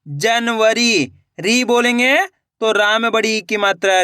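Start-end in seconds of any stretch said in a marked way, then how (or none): 0.0-1.1 January drawn out very slowly for dictation; slow dictation of January to the class; stretched out slowly. Shown as a demonstration. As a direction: draw January out so each sound is heard. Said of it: "वरी बोलेंगे तो वा जाएगा".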